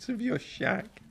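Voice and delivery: put-on high, thin old woman; old washerwoman voice. Washerwoman replies.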